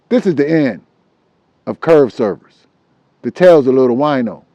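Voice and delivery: outro sign-off. Acted.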